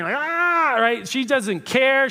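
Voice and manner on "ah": a short, high imitated wailing cry; mock wail. Shown as a demonstration. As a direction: pitch a brief demo wail way up. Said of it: "this is the wailing, erect type of crying".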